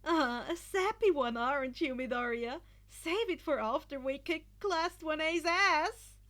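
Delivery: loud, high, cocky ribbing; lighthearted teasing. A narrator's reading. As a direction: Monoma teases Midoriya for being sappy, loud and playful. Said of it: "Monoma replied lightheartedly".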